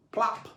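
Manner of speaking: a gentler echoing pop; plop sound effect. A narrator's reading. Second plop, softer.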